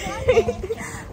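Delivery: high short laugh. Real, not acted. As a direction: burst out laughing.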